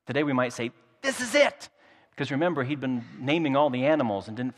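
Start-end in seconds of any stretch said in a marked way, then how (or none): 1.0-1.7 much higher, thrilled cry; excited exclamation. Acted. Adam seeing his match at last, a high thrilled cry. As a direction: burst out with delight you can't contain. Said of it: "He's so excited he can't even contain it".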